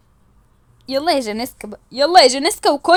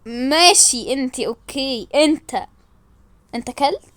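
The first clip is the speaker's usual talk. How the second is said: ordinary delivery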